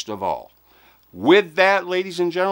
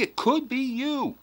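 playful singsong; 13 semitones higher, 3 dB quieter than usual. Acted. Teasing viewers about winning.

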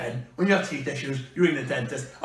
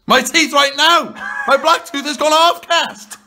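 loud, higher-pitched complaint; exasperated outburst; 6 semitones higher, 5 dB louder than usual. Real, not acted. Explaining the blackened tooth to a mate, exasperated outburst. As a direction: cry out in indignation. Complaining about discoloured teeth.

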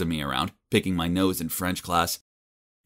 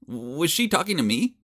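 plain reading voice

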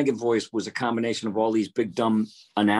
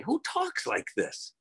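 mocking announcer; 8 semitones higher, 4 dB quieter, slower than usual. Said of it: "I used to say".

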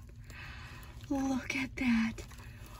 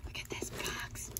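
whisper; 10 dB quieter than usual. Real, not acted. Whispering excitedly over a gift box.

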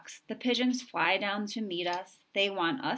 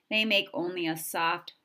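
plain reading voice

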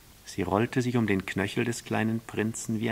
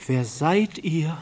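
slow, higher, louder question; astonished storybook prince; greatly astonished; 7 semitones higher, 6 dB louder, slower than usual. A narrator's reading. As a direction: ask in open amazement. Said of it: "fragte sie höchst erstaunt".

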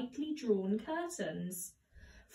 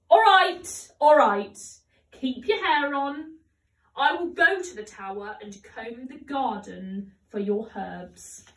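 exasperated; 9 dB louder than usual. A narrator's reading. Dan gives in, exasperated.

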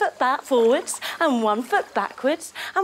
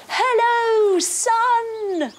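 high, loud greeting to the sun; cheery hello; 11 semitones higher, 4 dB louder, much slower than usual. Pretend. Kids' yoga leader greeting the sun, bright and loud. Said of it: "we say to the sun".

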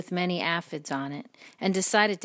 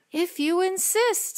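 high, lilting storybook reply; obliging reply; 13 semitones higher, 4 dB louder, slower than usual. A narrator's reading. Creature accepting the ladybug's challenge, high and lilting. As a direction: accept the dare in a raised voice.

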